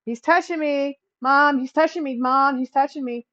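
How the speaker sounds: high, loud tattling whine; whiny tattling little sibling. Retold or imitated. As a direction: whine to Mom like a little kid. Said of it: "reminds me of that commercial".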